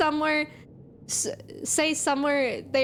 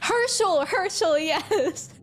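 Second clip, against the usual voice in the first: excited naming; 6 semitones higher, slower than usual. Real, not acted. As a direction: call out the name Herschel eagerly.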